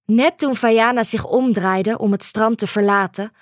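plain reading voice

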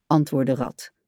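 ordinary delivery

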